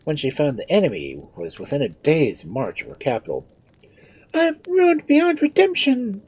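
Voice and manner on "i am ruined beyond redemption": very high, loud queen's wail; panicked royal lament; despairing cry. A narrator's reading. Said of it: "cried the Queen".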